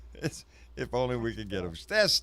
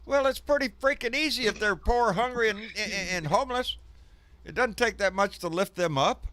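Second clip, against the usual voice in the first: impassioned; 11 semitones higher, 5 dB louder than usual. Real, not acted. Passionate plea about the poor.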